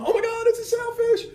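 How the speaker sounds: very high-pitched excited mock yell. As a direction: imitate the captain hollering in a high, strained voice. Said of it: "And he starts yelling".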